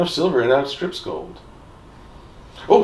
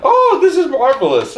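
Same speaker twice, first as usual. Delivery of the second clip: gleeful; 11 semitones higher, 5 dB louder than usual. Real, not acted. Gleeful at causing trouble.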